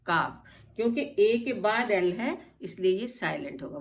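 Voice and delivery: normal talking voice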